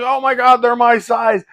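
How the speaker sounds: high, loud, amazed outburst; excited exclamation. Retold or imitated. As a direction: blurt it out like you just spotted your match. Retelling first sight of fighters.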